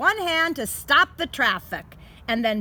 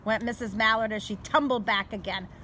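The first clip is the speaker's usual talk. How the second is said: plain reading voice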